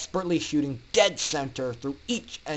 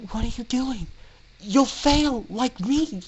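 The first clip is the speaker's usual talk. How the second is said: injured pony; 8 semitones higher than usual. Acted. Injured Aurora cries to Orion.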